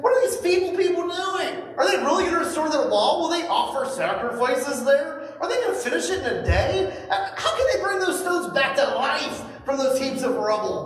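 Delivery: high, laughing mockery; ridiculing sneer. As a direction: jeer at the builders with a laugh.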